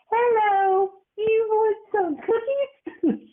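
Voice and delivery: put-on very high, slow, sugary old lady voice; sweet grandma voice. Grandson imitating grandma sweet-talking guests, very high and slow. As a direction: coo it like a sweet grandma offering treats. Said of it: "she'd be like".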